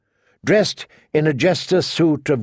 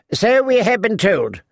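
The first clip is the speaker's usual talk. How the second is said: put-on higher, insistent old lady; refusing to be hushed; 8 semitones higher than usual. A narrator's reading. Lady Alina will not be hushed, higher and insistent. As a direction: cut back in, higher-pitched. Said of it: "no intention of being hushed".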